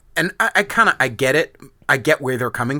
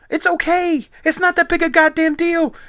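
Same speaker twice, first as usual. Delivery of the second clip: mock reassurance; 14 semitones higher than usual. Mocking dismissive reassurance.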